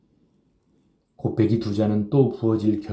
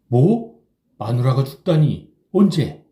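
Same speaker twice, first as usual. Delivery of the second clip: shock; 5 semitones higher, 7 dB louder, slower than usual. A narrator's reading. Chisam hearing wife died.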